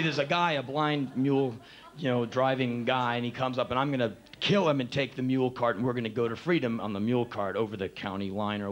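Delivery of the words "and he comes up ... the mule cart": ordinary talk, nothing marked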